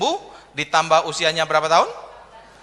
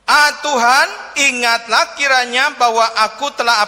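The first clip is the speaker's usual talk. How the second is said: fervent prayer; 5 semitones higher, 6 dB louder than usual. Retold or imitated. Quoting Hezekiah's prayer.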